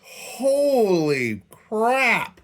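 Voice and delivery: slow, high "Holy crap"; drawn-out amazed exclaim. Real, not acted. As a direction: stretch it out in disbelief. Lantern finally fixed, elated.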